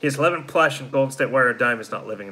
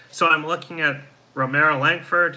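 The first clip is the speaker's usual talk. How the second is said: ordinary delivery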